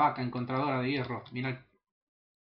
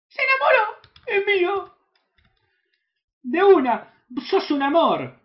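ecstatic squeal; 11 dB louder, slower than usual. Real, not acted. Wolf tamed, he celebrates.

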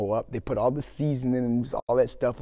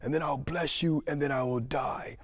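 frail dying father; 4 semitones higher than usual. Acted. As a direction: play a feeble father near death. Old, blind, dying Isaac speaks.

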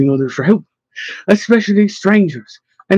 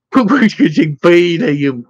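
moaning; slower than usual. Acted. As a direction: moan it out to your friend, slowly.

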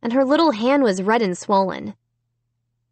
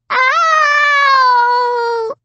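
put-on long, loud child's howl of pain; very high-pitched drawn-out cry; high heartbreaking wail; 6 dB louder than usual. A narrator's reading. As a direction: wail like a hurt little girl. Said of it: "uttered a high, heartbreaking wail".

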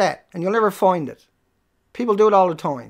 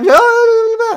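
high, loud mock babble; mocking blather; 12 semitones higher, 5 dB louder, slower than usual. Streamer mimicking repetitive chat posters, high mocking babble. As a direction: squeak out their nonsense dismissively. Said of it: "you're gonna come in here all the time".

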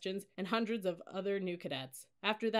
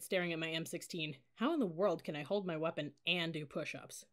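plain reading voice

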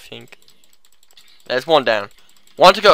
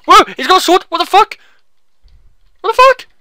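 high, loud startled outburst; shrill-pitched loud disbelief; alarmed yelling; 10 dB louder, faster than usual. Real, not acted. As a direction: yelp it in gamer panic. Sword zombie attacks him in game.

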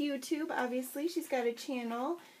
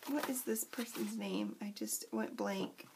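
whispered aside; 3 semitones lower, 7 dB quieter than usual. Real, not acted. Forgets name, asks herself.

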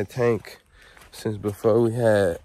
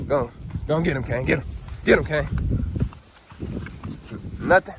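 dog commands; 5 semitones higher, faster than usual. Real, not acted. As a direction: urge the dog on, then praise him. Urging the dog on.

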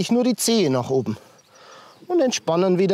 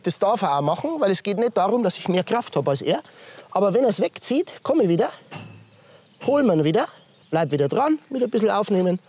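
usual speaking voice

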